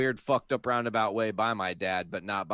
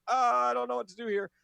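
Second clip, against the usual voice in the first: mock panicked; 13 semitones higher, 3 dB louder, faster than usual. Panicked reply to mom.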